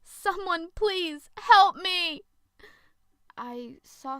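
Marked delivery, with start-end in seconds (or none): 0.0-2.2 slow, much higher, loud scream for help; desperate scream. Acted. Zoe fleeing the creature, screaming for help. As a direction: scream it out as loud as you can. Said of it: "I screamed on the top of my lungs".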